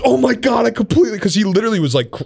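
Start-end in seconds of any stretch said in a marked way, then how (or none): 0.0-1.2 high, apologetic gasp of realisation; mock alarm. Stripper who forgot his stitches, in mock high-pitched alarm. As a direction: imitate her sudden horrified remembering.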